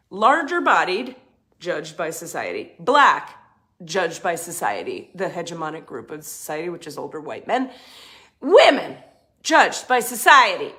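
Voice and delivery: rising indignation on key words; emphatic list. Real, not acted. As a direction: hit the key words hard. Punched emphasis.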